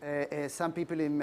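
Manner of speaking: normal talking voice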